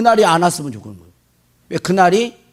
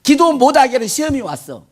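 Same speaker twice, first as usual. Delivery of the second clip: ordinary talk, nothing marked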